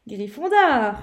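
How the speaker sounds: a sudden loud house name; decisive booming verdict; announcement. Acted. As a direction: ring it out through the great hall. Hat announces final choice.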